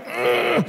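imitated strained "ugh"; mock straining grunt. Imitates branch straining to make fruit.

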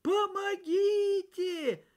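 high, slow cry for help; boy tied to a tree crying out for help; shouting for help. A narrator's reading. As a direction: call out for rescue, drawn out. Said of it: "закричал".

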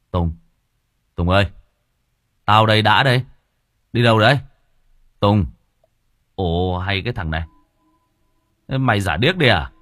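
loud shout after a friend; Đã shouting after Tùng in the street; calling out. Acted. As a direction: call him across the dark road. Calls out to friend.

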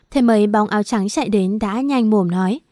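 ordinary delivery

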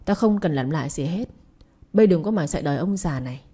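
ordinary delivery, nothing marked

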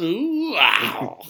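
high squealing put-on rocker voice; [name] impression. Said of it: "my best [name] impression".

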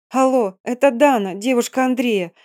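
stumbling over words in panic; panting shaky eyewitness. Acted. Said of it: "стала сбивчиво говорить я".

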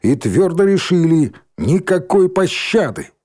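loud, high, emphatic declaration; hard verdict. Acted. Girl declares merciless verdict.

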